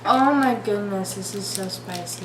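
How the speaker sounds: ordinary delivery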